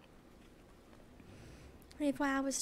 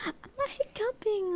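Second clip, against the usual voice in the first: high indignant squeak; squeaky denial; 9 semitones higher than usual. Real, not acted. Denying hiccups, squeaky.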